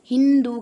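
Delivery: Hindu with a loud, drawn-out हिं; stress on first syllable. Shown as a demonstration. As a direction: lean hard on the opening of Hindu. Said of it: "ही में बलाघात दिया".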